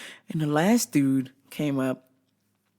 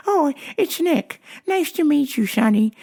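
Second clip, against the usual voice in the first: put-on old male customer; 10 semitones higher, 4 dB louder than usual. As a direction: play the customer in a high voice. Voicing old customer in retold chat.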